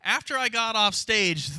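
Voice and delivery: normal talking voice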